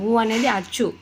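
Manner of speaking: ordinary talk, nothing marked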